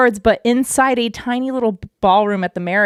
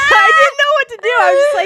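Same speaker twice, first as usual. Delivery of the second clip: flustered, much higher and louder, half crying; starstruck; 6 dB louder, faster than usual. Retold or imitated. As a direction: gush it out, overwhelmed by meeting a hero. Starstruck meeting James Cameron.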